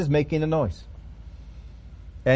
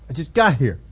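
lower, put-upon complaint; exasperated Moses; 4 semitones lower than usual. Acted. Moses protesting to God, lower and put-upon. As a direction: whine it, just arrived.

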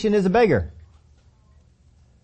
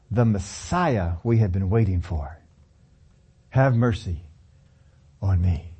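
sincere plea; 10 semitones lower, 3 dB quieter than usual. Retold or imitated. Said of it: "preacher voicing the beggar's faith".

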